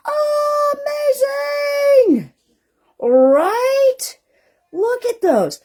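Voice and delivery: high, loud delighted squeal; excited squeal. Real, not acted.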